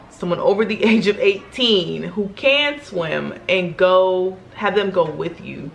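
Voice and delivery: normal talking voice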